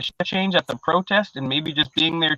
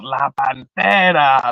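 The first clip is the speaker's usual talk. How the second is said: drawn-out slow Spanish call of the nickname; Spanish announcer call; 4 dB louder, much slower than usual. Retold or imitated. Announcer calling a home run, slow Spanish. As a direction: stretch "La Pantera" slowly like a hype call. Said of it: "he starts speaking in Spanish".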